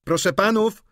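high, loud servant's announcement; calling out. A narrator's reading. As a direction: call the gentlemen to table, pitched high. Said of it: "zawołał Krzysztof".

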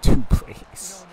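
laughing loudly through the words. Real, not acted. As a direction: crack up mid-sentence. Laughing at own big loss.

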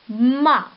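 "ma" said alone, loud and clear as one syllable; second syllable ma. Shown as a demonstration. Teacher adding a second syllable, crisp and loud. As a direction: sound out "ma" for the class. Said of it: "c'est une deuxième syllabe".

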